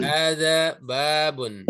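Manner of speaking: Hadza baitun drawn out slowly, syllable by syllable; a teacher modelling Arabic for students to repeat; slow model pronunciation. Shown as a demonstration. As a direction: say Hadza baitun slowly so the class can copy. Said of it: "Ikuti saya".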